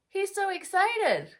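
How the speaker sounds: thrilled storybook voice; overdone excitement. Shown as a demonstration. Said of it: "you can overdo your excitement".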